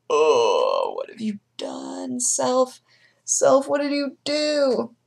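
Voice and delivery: hint of a pained moaning whisper; aching arms voice. Her sore arms scolding her, pained slow whisper. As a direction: let her sore arms complain, drawn out. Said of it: "my arms were like".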